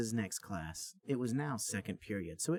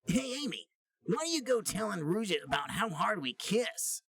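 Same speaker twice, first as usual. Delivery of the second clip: higher, smiling cocky voice; smug Sonic; 10 semitones higher, 3 dB louder than usual. Acted. Sonic confronting Amy, smiling and smug. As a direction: say it grinning. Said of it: "said Sonic with a smile".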